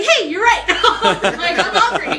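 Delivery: high, loud and laughing through it. Real, not acted. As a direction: giggle at the reminder. Laughing at phone reminder.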